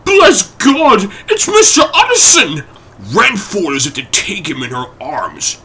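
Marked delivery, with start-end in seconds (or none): 0.1-2.6 shrill cry, much higher and louder. Acted. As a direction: cook shrieking with relief. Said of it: "the cook crying out".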